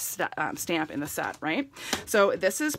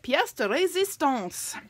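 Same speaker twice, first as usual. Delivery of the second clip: careful, slow French pronunciation of "pièce de résistance"; a hammed-up French accent on "pièce de résistance"; 6 semitones higher, slower than usual. Shown as a demonstration. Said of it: "How's my French accent".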